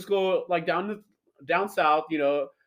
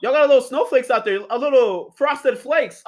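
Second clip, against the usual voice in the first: loud, higher playful ribbing; playful mock about the flakes; teasing; 6 semitones higher, 6 dB louder than usual. Real, not acted. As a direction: poke fun at the snow.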